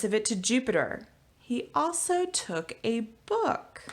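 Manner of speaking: lively picture-book read-aloud; reading to children. Acted. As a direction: read it to a class of kids, rhyming and bouncy. Teacher reads picture book aloud.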